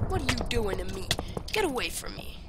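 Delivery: rattled off fast, loud; fast panicked protest. Real, not acted. Gamer attacked by another player, rattling it off fast and loud. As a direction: blurt it out in a rush.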